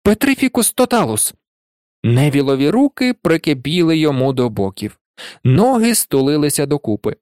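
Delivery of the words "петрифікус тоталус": high-pitched spell casting; sharp magical command; exclaimed incantation. A narrator's reading. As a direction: call out the spell decisively. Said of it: "і вигукнула".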